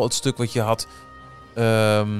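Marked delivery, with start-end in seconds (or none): none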